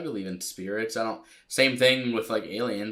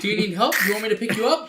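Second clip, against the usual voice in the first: high mock babying voice; 8 semitones higher than usual. Joking continuation of sister's voice.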